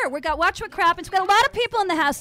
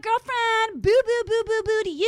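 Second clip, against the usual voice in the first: higher, louder sing-song boos; a put-on higher Nene voice, playful and loud; Nene impression; 5 semitones higher, 4 dB louder, slower than usual. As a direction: bounce up high on the boops. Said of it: "I could do Nene".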